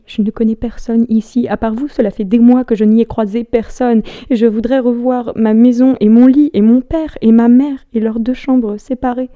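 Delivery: building to a loud, high-pitched outburst; impassioned stage plea, swelling then easing; desperate plea. Acted. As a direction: pile up each item with mounting force.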